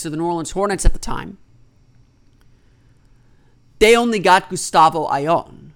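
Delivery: ordinary talk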